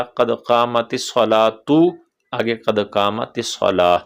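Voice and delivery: slow सलातु then सलाह; joined then paused. Shown as a demonstration. Teacher repeating the joined then paused forms. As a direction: link the first, stop the second. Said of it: "तो पहला".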